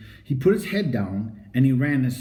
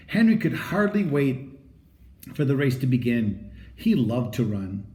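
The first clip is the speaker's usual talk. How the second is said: plain reading voice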